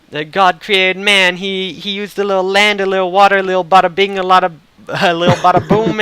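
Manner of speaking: high, loud, bad Brooklyn accent; hammed-up Brooklyn mob-lord preacher; imitated Brooklyn priest. Priest explaining creation at a wedding, hammy Brooklyn accent. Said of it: "lapsing into a terrible Brooklyn accent".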